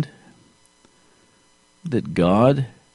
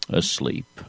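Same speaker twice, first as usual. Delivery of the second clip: hushed whisper; 3 semitones lower, 8 dB quieter than usual. Acted. Preacher whispering to the congregation about sleeping Jesus, hushed. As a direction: breathe the word asleep, almost soundless.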